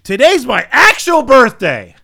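put-on loud, high, slow old lady yell. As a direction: belt it out like a cranky old lady. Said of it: "This old lady in the back yells".

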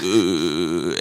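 long, drawn-out French "euh"; mock French hesitation noise. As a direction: imitate a French speaker's filler. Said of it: "And he's like".

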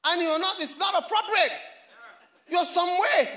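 loud, high preacher's cry; preaching at full force. Acted. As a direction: preach it out, pushing each line.

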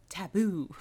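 drawn-out quoted catchword; Matthew. Retold or imitated. Podcast guest names her favorite quotable word, imitating its delivery. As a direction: say it as a lightly mimicked catchphrase. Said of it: "has to be said the way Matthew says it".

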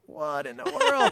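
stretched-out incredulous voice; mock astonishment. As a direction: imitate gawking onlookers, slow and high. Onlookers' baffled reaction acted out.

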